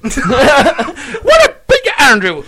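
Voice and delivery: loud Belfast soap-dad greeting; Jim McDonald impression. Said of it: "do you want Jim McDonald?".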